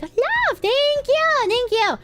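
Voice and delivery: high cutesy thank-you; high squeaky thanks. Acted. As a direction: squeal your thanks sweetly. Gushing thanks.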